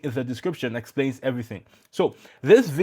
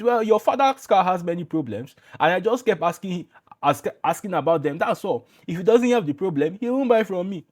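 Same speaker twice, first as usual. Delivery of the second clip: put-on Igbo salesman accent, pitched higher; light Igbo accent, higher voice; 5 semitones higher than usual. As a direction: mimic a Nigerian Igbo trader's accent. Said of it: "he said it with his Igbo accent".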